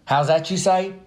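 lightly voiced listener question; mock listener. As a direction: play the puzzled audience asking. Imagined listener asks question.

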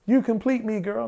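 high, crooning lover's line; mock romantic line. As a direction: ham up a cheesy movie confession. Mocking a romantic movie line.

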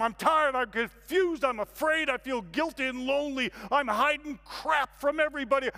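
loud, high-pitched tirade of self-exposure; ranting confession. Acted. Man unloads his secrets on silent room, ranting loudly. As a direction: let every grievance burst out loudly, easing at the end.

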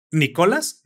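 "Nicolás" with the accent on CO, "ni-CO-las"; misplaced stress. Shown as a demonstration. Showing friend clean sounds but wrong accent, misplaced stress. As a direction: stress the middle syllable of "Nicolás". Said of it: "está perfectamente bien pronunciado".

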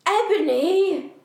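loud, slow, high yell. Retold or imitated. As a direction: bellow the name out slowly. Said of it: "he yelled".

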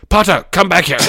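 high, loud angry shout. A narrator's reading. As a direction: yell it after someone running off. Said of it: "Oh, shouted".

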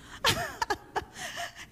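a bright squealing laugh; high laugh. Real, not acted. Preacher laughing at the pastor's scolding, high laugh.

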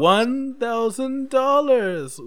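high, drawn-out prize reveal; mock-grand announcement. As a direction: announce the jackpot with overblown fanfare.

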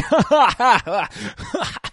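loud, high burst of laughter; big high-pitched cackling laugh; laughing at own joke. Real, not acted. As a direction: crack up at your own joke.

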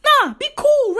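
high-pitched protest; exasperated outburst. Real, not acted. Telling companies to be cool, high exasperated cry. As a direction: shoot the pitch up, fed up.

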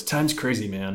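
laid-back stoner drawl; lazy, dreamy hippie delivery. Shown as a demonstration. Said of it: "I'm gonna say it in my, uh, my hippie voice".

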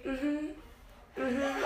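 imitating a bored date's minimal replies; disengaged mm-hmm. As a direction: give token, closed-mouth hums. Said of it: "just me talking and him being like".

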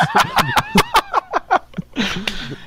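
long, high peal of laughter. Real, not acted. Coworker cracking up over the ten dollar tips, long laughter. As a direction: crack up at length. Group cracks up at tip story.

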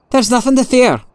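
high-pitched, insistent reassurance; uneasy, strained-high repeat of a stock phrase; agitated reassurance. A narrator's reading. Prisoner pulls hand away, insists.

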